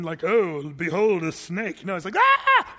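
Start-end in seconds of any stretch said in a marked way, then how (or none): 0.2-1.8 slow, grand epic-hero voice; louder, drawn-out mock solemnity; Charlton Heston. Preacher showing how Moses did not react to the snake, as pompous Charlton Heston. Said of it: "See, this isn't Charlton Heston". 2.2-2.7 high, loud comic shriek; hammed-up yelp of terror; panicked scream. Preacher acting Moses seeing the serpent, a freaked-out scream. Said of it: "He freaks".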